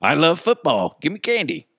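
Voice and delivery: put-on kid voice. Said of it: "I'd be like".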